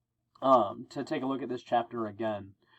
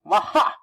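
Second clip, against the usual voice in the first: high, loud "waha" whoop; startled yell; 7 semitones higher, 7 dB louder than usual. Acted. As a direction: yelp out loud mid-swing. Spidey yells mid-swing.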